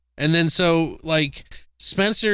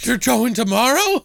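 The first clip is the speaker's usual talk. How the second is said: higher, louder panicked cry; alarmed outburst; 10 semitones higher, 5 dB louder than usual. Retold or imitated. Crazed janitor hearing of the trip, loud panic. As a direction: blurt it out in a panic.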